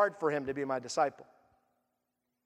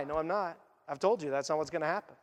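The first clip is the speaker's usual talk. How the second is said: Jesus answering the disciples, lower and softer; Jesus correcting gently; 6 semitones lower, 5 dB quieter than usual. Acted.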